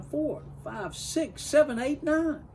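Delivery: greedy gobbling count rising; building count. A narrator's reading.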